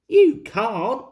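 loud, high retort; indignant. Acted. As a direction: snap back loudly. Bertie contradicts Thomas.

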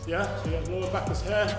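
ordinary talk

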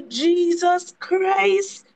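drawn-out Jesus Christ, slow; slow exasperated sigh. Retold or imitated. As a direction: stretch it out in disbelief.

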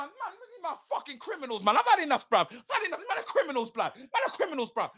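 ordinary delivery

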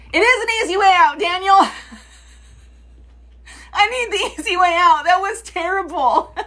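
high, fast, laughing. Real, not acted. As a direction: laugh through the words.

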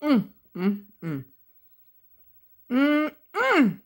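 humming with lips closed; savoring a bite; chewing with mouth full. Real, not acted. Seen 4: Fork at mouth at 0.1, then closed-lip chewing while humming.

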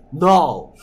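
loud blunt refusal; rude no. Shown as a demonstration. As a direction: bark a rude no. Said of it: "rude한 표현이라서 no라고 거절하지 않는다". (무례하게 크게 거절)

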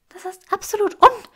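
viel höher und lauter, entrüstet mitten im Wort abreißen; empörte Hexe, die gleich platzt; empört abgebrochen. Acted. Granger bricht empört ab. (höher und lauter empört abbrechen)

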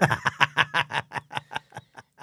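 loud amused laugh; hearty laugh. Real, not acted. Guest laughing at his mother's answer, hearty laugh. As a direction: burst out laughing at the memory.

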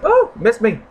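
high, loud missed me; taunting relief. Real, not acted. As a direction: tease the trap you dodged. Dodging in game.

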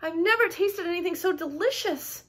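high-pitched savouring; storybook rapture; delighted. A narrator's reading. Larch savours the juice.

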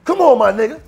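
pleading shout. Retold or imitated. Begging his way into party.

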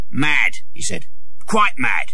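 a hint of a scornful father; lightly gruff father. A narrator's reading. John Canty judging the boy mad, lightly scornful. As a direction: dismiss him as mad.

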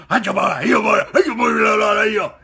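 loud, high whooping gibberish chant; mock play-acting gibberish. Young actor on set doing his childish role, hammed-up hollering. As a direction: holler it like a kid playing make-believe. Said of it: "that's how we talked Indian".

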